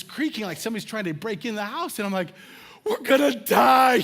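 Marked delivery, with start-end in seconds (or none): 2.8-3.9 loud, drawn-out doomed wail; higher, slow hammed-up cry of dread; mock panic. Retells panic during break-in.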